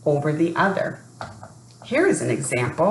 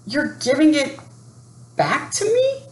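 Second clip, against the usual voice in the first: rising, much higher puzzled disbelief; puzzled question; 11 semitones higher than usual. Acted. Boy amazed his money box is given back, puzzled rising question. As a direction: ask in happy, puzzled surprise.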